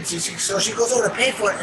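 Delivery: ordinary talk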